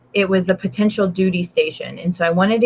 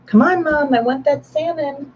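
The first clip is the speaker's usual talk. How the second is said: higher pleading dog voice; voicing the dog; 7 semitones higher than usual. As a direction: beg Mom for salmon as the dog, pitched up. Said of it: "So now she's gonna be like".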